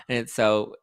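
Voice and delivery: ordinary talk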